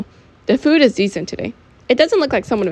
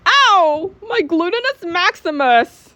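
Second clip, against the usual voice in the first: high, drawn-out comic wail of pain; hammed-up ow, slow and pitched way up; hurt nerd; 10 semitones higher, much slower than usual. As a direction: cry out in a put-on high nerd voice. Nerd falls off pulled chair.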